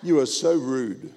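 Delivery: ordinary talk, nothing marked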